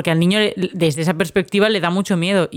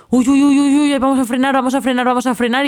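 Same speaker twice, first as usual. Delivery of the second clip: high, loud alarmed cries; panicked movie character; 7 semitones higher, 4 dB louder than usual. Retold or imitated. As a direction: squeal it out like you're about to crash. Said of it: "en plan de".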